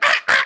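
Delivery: loud mock scream of fright; hammed-up startled yelp. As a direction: yelp as if a raccoon appeared. Acted scream at raccoon.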